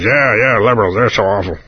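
low, soft, grumbling Cheney; Cheney impression. Cheney muttering agreement to the gun lobby, low and soft. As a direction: mumble it low and bored like Cheney. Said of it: "My first Cheney impression".

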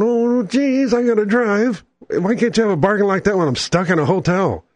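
mock exasperated whine. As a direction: lament the missed bargain, playfully. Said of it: "And I'm going".

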